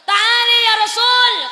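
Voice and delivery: loud, high and slow call. Acted. Moses calling out to the Prophet, loud and slow. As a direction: draw the call out across the heavens. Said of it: "kata Nabi Musa".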